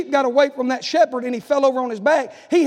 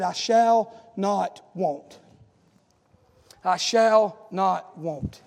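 slow emphasis; 5 semitones lower, much slower than usual. Acted. Preacher to congregation, slow deliberate scripture. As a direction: drag each word out.

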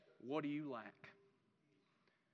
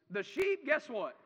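normal talking voice

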